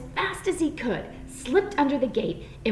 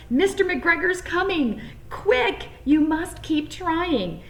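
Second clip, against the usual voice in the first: urgent sparrows; 7 semitones higher, slower than usual. A narrator's reading. Sparrows warning Peter, high urgent voices. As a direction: hurry him, little birds. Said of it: "urged some friendly sparrows".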